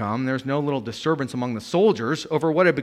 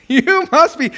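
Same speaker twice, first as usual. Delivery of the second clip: mock scoff; 13 semitones higher, 7 dB louder than usual. Repeating the scoffing quote.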